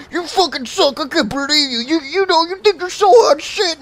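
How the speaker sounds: very high, squealing tantrum parody; mock a kid losing it over a game; imitated raging kid. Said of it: "raging and raging and raging".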